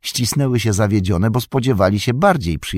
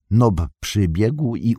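usual speaking voice

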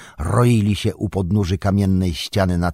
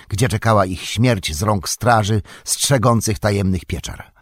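ordinary delivery

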